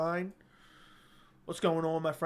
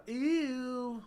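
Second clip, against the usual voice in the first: high, far-off squeal of disgust; 7 semitones higher than usual. Acted. Streamer reacting to Wingstop fans, high disgusted ew. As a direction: recoil with a high ew.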